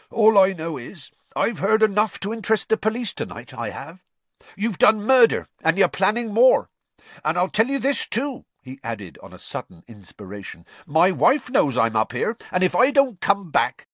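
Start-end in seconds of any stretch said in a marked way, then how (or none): 0.1-8.4 high, loud, indignant challenge; defiant accusation. A narrator's reading. Intruder threatening to tell police about murder, defiantly. 10.8-13.9 raised, louder, urgent warning; defiant bluff. A narrator's reading. Intruder bluffing that someone expects him back, defiantly. Frank bluffs a threat to the master.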